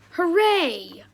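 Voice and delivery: high, loud mock cheer. Imitating proud parents cheering their son's goal, loud.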